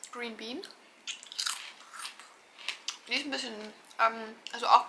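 talking between bites; slightly muffled chewing speech; speaking while chewing. Real, not acted. Seen 0: Lips pressed, chewing motions between phrases; crisps bag shown.

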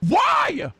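high exasperated shout; real high-pitched yelp; frustrated squeal. Real, not acted. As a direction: shriek it in frustration. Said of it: "I sound stupid".

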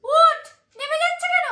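very high anxious squeal; panicked squeal. Acted. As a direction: shriek at the falling number. Bet multiplier falling.

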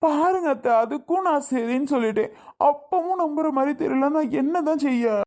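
high, pleading frustration; exasperated protest. Acted. Man protesting to his doubting mother-in-law, exasperated and high-pitched. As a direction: protest helplessly, pitch lifted well up.

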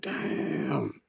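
breathless hushed damn under the breath; whispered damn. Retold or imitated. Seeing the girl from the story, a whispered damn. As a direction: let it slip out as a soft whisper.

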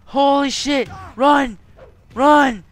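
high, loud panicked yells; urgent shouting. Real, not acted. Player urging his character to escape, urgent shouting. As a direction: yell for them to flee.

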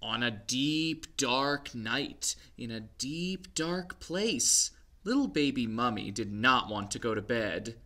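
lively picture-book read-aloud; story reading to children. A narrator's reading. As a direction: read it to little ones.

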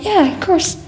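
high-pitched, exclaimed retold reply; of-course-I-know-you tone; incredulous reassurance. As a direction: re-enact answering an old friend, voice shooting up. Own surprised reply to nervous friend.

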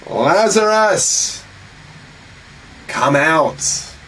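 loud cry. Acted. Jesus calling Lazarus out of the tomb, loud and drawn out. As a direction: call him out of the grave. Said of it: "he cried out in a loud voice".

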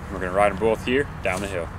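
ordinary delivery

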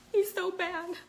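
high slow gasped "so bad"; mock scolding. Teasing her baby about the door, mock gasping scold. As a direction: pretend outrage, drawn out.

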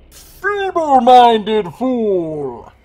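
slow, loud, high ghostly chiding; unearthly voice. Acted. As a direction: sneer it out like a phantom. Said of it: "chides a ghostly voice".

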